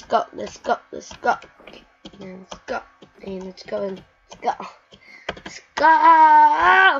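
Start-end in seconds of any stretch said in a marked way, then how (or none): 5.4-7.0 loud, high burst of go; excited shout. Real, not acted. Gamer hyping the jump, loud excited call. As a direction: cheer yourself on loudly.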